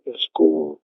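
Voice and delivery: ordinary talk, nothing marked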